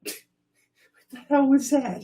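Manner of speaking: high, surprised outburst; startled. Real, not acted. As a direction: jump at the sudden noise. Startled by the randomizer sound.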